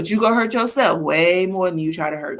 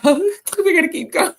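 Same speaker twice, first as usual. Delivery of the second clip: talking through real laughter, higher and louder; laughing; 8 semitones higher, 5 dB louder than usual. Real, not acted. Laughing as she tells viewers they must move on. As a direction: still giggling from the funny story as she moves on. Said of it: "That was just funny".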